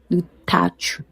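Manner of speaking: "Du Tach" chuchoté pour soi; whispered. Shown as a demonstration. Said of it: "Ça se prononce comme si tu étires". ("Du Tach" chuchoté)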